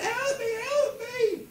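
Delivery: very high, drawn-out comic wail for help; shouted plea. Retold or imitated. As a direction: cry for help as mum flying away. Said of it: "and she shouted".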